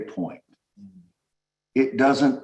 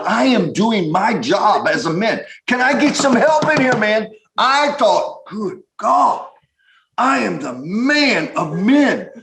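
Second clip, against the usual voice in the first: proud he-man voice; 8 semitones higher than usual. Retold or imitated. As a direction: puff up like the hero of the date. Husband voicing his proud self.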